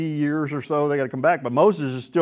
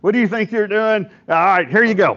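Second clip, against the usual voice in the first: high, raised-voice scolding; hammed-up, exasperated outburst, pitched up; mock angry yelling; 7 semitones higher, 4 dB louder than usual. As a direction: imitate a leader yelling at his rebels. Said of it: "he screams at him, yells at him".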